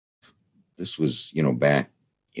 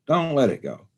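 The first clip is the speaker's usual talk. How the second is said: high, loud yelled refusal; farmer yelling out; 13 semitones higher, 5 dB louder, slower than usual. Retold or imitated. Farmer answers the disembodied voice, yelling out. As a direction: yell back high, as the alarmed farmer. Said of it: "the farmer yells out".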